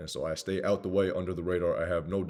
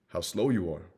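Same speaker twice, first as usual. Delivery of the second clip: ordinary talk, nothing marked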